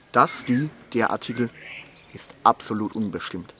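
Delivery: measured, playful poetry reading with pauses; comic poem recitation. Acted. Poet reads new grammar poem to audience, playful recitation.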